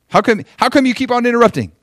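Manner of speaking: high, loud, rapid challenge; mock scolding. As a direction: fire off an exasperated complaint in imitation. Imitates scolding a newcomer.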